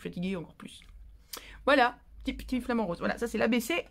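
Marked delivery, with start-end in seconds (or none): 0.4-0.8 whispered aside; confiding whisper. Real, not acted. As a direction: drop to a whisper for the punchline. Aside about being tired.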